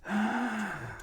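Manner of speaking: sharp high gasp; a startled intake of breath. A narrator's reading. Story opens with dramatic gasp.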